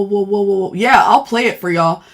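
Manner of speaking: ordinary talk, nothing marked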